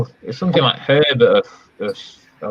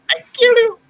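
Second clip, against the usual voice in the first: much higher, louder mock puppet voice; Ahmed the Dead Terrorist impression; 5 dB louder than usual. Shown as a demonstration. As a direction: bark it out as the puppet's catchphrase. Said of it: "Say it".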